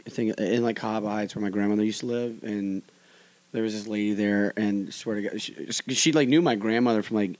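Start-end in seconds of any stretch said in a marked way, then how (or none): none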